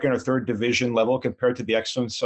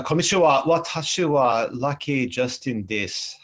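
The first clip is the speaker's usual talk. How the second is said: spoken Japanese. Shown as a demonstration. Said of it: "in six different languages".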